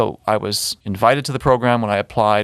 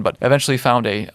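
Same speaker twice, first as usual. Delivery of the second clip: ordinary talk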